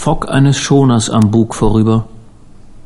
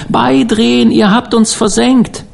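high, thin voice from a distant phone; distant telephone voice; 10 semitones higher than usual. A narrator's reading. Distant voice accusing the boat that rammed them, high telephone voice. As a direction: call out high, like a faraway voice on the line. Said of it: "ganz klein in seinem Ohr eine entfernte Telefonstimme".

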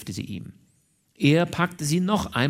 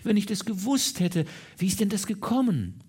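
pitying, emotional outpouring, climbing higher; stammered sympathy; 5 semitones higher than usual. A narrator's reading. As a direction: gush with compassion for a sweetheart. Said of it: "indem sie stammelte".

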